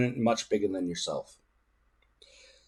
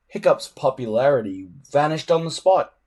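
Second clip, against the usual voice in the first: ordinary delivery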